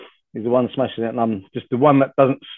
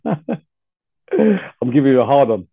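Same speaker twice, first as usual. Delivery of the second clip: laughing; much slower than usual. Real, not acted. Laughing banter between hosts.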